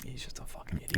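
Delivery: breathy whispered jab, barely voiced; whispered aside; whispered insult. Real, not acted. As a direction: hiss it under the breath. Muttered aside, unvoiced.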